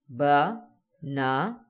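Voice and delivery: "ba-na-na" very slowly, a pause after each syllable. Shown as a demonstration. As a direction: break it into ba, na, na. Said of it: "Each time your chin drops".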